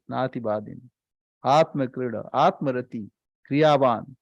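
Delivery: low, measured Sanskrit chant of the verse; scripture recitation. Acted. Swami intoning the Upanishad verse to his listeners, low measured chant.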